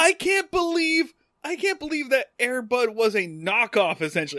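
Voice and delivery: high, put-on shocked disbelief; mock disbelief. Co-host fakes outrage that Air Bud copied Gus, high-pitched. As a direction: act scandalized, pitch way up.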